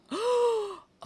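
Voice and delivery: sharp high gasp of delight; loud excited gasp. Real, not acted. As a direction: gasp at how good it looks. Delighted at new look.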